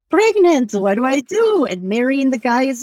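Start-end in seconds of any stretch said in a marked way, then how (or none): 0.1-1.7 mock panic. Retelling panic at fifteen.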